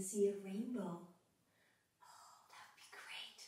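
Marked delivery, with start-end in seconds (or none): 2.0-3.4 soft hopeful whisper. Acted. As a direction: breathe it out like a wish. Whispered hope.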